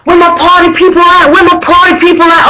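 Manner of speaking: high, chanted hype call. Retold or imitated. Fan imitating the frontman calling party people, rallying cry.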